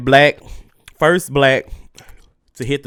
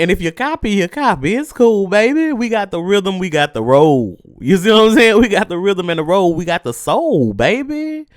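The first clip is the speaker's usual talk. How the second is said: playful swagger; 7 semitones higher, 3 dB louder than usual. Real, not acted. Playful boastful riffing.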